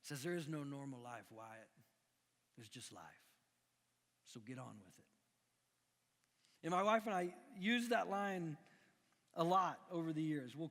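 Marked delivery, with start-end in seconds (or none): 0.2-5.0 put-on Doc's country accent. Dying Doc answering Wyatt in a country accent. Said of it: "he says it in an accent, country boy".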